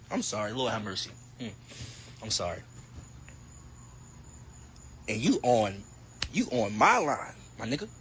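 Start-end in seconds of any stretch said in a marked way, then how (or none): 0.1-2.6 mock apology. Apologizing after mock rant.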